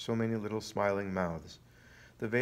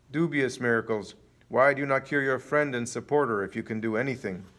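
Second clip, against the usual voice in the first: louder, scoffing demand; scornful challenge; 3 semitones higher, 6 dB louder than usual. A narrator's reading. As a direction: throw it out as a loud taunt. Enemies challenge the miracle worker.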